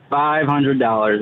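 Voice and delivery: slow, drawn-out words; deliberate price-naming. Acted. Kid names his price to the hiding man, slow and deliberate. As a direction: stretch out each word as the punchline price.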